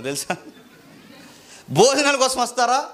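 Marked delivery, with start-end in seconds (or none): 1.7-2.8 loud, high incredulous question; a preacher outraged at his flock; indignant. Acted. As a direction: scoff at the very idea.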